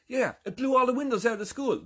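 high, excited mock report; animated confirmation. Mike tells of the school windows, imitated with high excitement. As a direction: pile on the detail eagerly, short of shouting. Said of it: "Mike goes".

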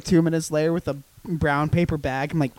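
ordinary talk, nothing marked

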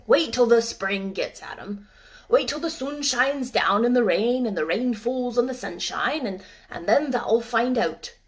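put-on gruff old gardener in dialect. Ben speaks.

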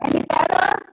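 garbled bad phone line; distorted, bubbling call audio; underwater. Real, not acted. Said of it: "Sounds like you're underwater".

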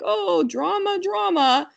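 high, teasing sing-song "drama, drama"; teasing singsong. Retold or imitated. Neighbors teasing over the screaming toddler, high sing-song. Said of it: "they're like".

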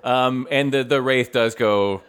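ordinary delivery

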